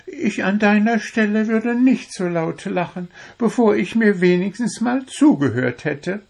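very high bird voice, unfazed; undeterred little bird. A narrator's reading. Little bird answers the laughing dwarf, undeterred. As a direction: carry on undaunted, high-pitched. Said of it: "sagte Zwitschi unbeirrt".

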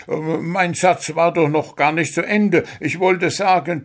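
high, hurried, faltering excuse; stammering excuse. A narrator's reading. Dwarf stammering to the referee to save himself.

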